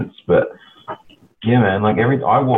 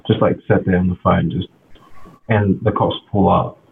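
normal talking voice

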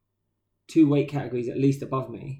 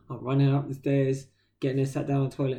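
ordinary talk, nothing marked

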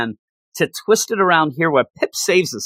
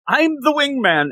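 higher, boastful buddy voice; proud mock Scourge; 9 semitones higher than usual. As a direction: announce it like a proud sidekick. Scourge proudly declaring himself.

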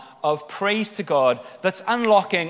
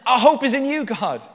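fervent declaration; 8 semitones higher, 5 dB louder than usual. Acted. Acts out people turning to God.

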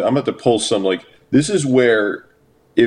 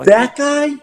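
incredulous; 4 dB louder than usual. Retold or imitated. Mocking disbelief at a crush.

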